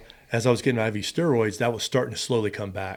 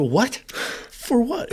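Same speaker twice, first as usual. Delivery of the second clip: shocked disbelief; 13 semitones higher, much slower than usual. Retold or imitated. Shocked reaction to spinal tap.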